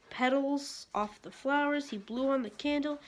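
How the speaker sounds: ordinary delivery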